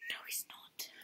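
soft sneaky whisper. Real, not acted. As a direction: whisper under your breath. Sneaking in the dark.

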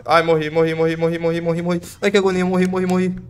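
high frantic panicked cries. Real, not acted. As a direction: squeal it in terror. Dying in horror game.

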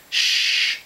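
sharp breathy librarian's shush; a stern library shush; warning shush. A narrator's reading. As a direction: hush them with a warning hiss.

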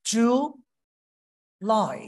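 জুলাই split slowly into জু and লাই; drawn out জুলাই, clapping each syllable; syllable-split demo. Shown as a demonstration. Teacher claps জুলাই into two syllables for the class. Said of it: "হাত জোর করেন আবার".

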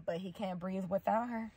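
usual speaking voice

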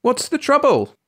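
high-pitched worried cry. A narrator's reading. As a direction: call out the question high in alarm. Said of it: "a woman cried".

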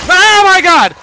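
loud, high terrified shout; panicked outburst at a scare; frightened scream. Real, not acted. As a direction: scream it in shock. Sudden scare.

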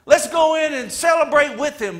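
high, loud delight; glad response. Acted. Brother hearing the news, loud happy reply. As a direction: cheer as if the news thrills you. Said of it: "the natural response would be".

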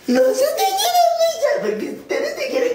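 very high, slow, laughing mockery of her; mock high voice, half laughing, half wailing; squeaky mocking quote. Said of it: "Y luego le dice algo".

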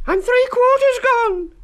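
very high, loud, slow wail; high drawn-out panic; frightened cry. A narrator's reading. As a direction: wail while being eaten. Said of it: "Then he cried".

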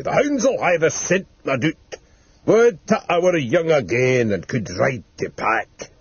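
lightly voiced wistful old huntsman; slow down into a mild, dreamy ramble; rambling old man. Delirious old man recalling the hunt, mildly wistful rambling. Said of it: "ever and anon he rambled".

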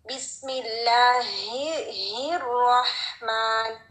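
बिस्मिल्लाहिर्रहमान slow with a strong ह. Shown as a demonstration. Teacher recites with a strong airy ह, slow. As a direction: lean hard on the ह, slowly. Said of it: "make it really strong".

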